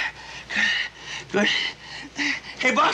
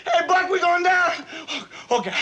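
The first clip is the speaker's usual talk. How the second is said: normal talking voice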